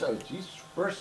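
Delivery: usual speaking voice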